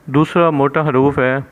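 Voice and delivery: ordinary delivery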